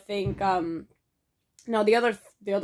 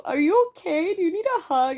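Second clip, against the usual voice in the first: high, babying retold concern; concerned cooing; 9 semitones higher, 4 dB louder than usual. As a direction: offer comfort in a high coo. Comforting the sulking friend.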